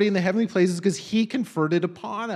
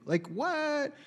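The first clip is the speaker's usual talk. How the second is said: stunned disbelief; 6 semitones higher, 8 dB quieter than usual. Real, not acted. Astonished at the verse.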